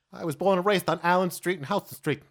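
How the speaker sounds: put-on loud, high New York accent; New Yorker accent. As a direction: play a proud New York local at full volume. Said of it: "If there was a New Yorker there".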